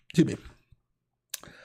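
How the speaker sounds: barely voiced, sore throat; hoarse whisper. Real, not acted. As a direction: voice gone, breathy croak. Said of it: "Colds suck".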